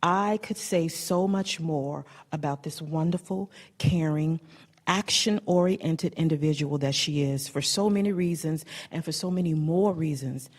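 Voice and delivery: usual speaking voice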